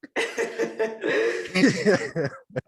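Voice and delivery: long howling laugh; loud high laughter. Real, not acted. Host cracking up at his own joke, loud laugh. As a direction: crack up loudly.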